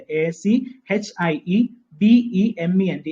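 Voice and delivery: each letter said slowly and separately; teacher spelling out the word slowly. Shown as a demonstration. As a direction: dictate letter by letter. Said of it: "ഐയും ഇയും ശ്രദ്ധിച്ചോണേ".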